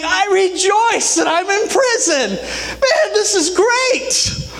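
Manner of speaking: high, laughing, jubilant cry; joyful exclaim. Acted. Paul rejoicing in his prison cell, high and jubilant. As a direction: burst out with joy at the chains.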